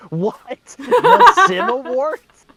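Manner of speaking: high, loud impersonated yell of disbelief; Richard Horvitz. Retold or imitated. Actor hearing about the fan awards, imitated in a high shout. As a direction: shriek it as the astonished actor. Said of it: "a really good Richard Horvitz impression".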